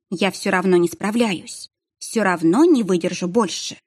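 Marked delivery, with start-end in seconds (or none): none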